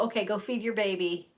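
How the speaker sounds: ordinary talk, nothing marked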